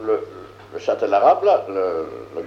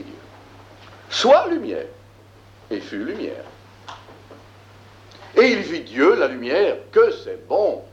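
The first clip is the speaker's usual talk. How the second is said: measured, declaiming biblical verse; solemn scripture reading; 3 semitones higher than usual. Acted. Teacher reading Genesis aloud to the class, solemn scripture reading. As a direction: read it like sacred text, weighty.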